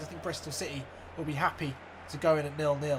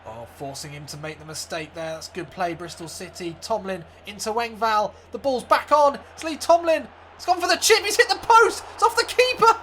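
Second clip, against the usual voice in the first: play-by-play building to a much higher, louder yell at the post; live commentary; 4 semitones higher, 8 dB louder than usual. Acted. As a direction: call the chance rising to a scream. Commentator calling the match.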